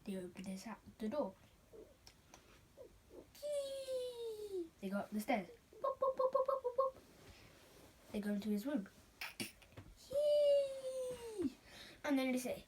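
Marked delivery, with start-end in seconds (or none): 3.4-4.6 high squeaky creak; creaky door squeak. Retold or imitated. Door creaks open sound. 5.8-7.0 much higher, bouncy stair steps; climbing the stairs; high boop footsteps. Retold or imitated. 10.1-11.6 high squeaky creak; creaky door squeak. Retold or imitated.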